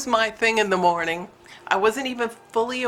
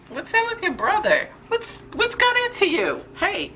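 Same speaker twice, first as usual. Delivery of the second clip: pet scolding; 11 semitones higher than usual. Real, not acted. Owner asking cat what got into him, high-pitched chiding. Questioning her feisty cat.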